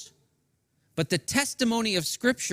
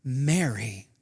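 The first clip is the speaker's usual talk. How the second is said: Jesus calling her name; 6 semitones lower than usual. Retold or imitated. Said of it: "The same voice called again".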